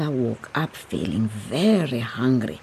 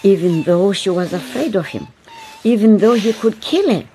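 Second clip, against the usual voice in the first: louder, urgent storytelling; tense narration; 5 semitones higher, 4 dB louder than usual. A narrator's reading. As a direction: build the danger. Narration builds to danger.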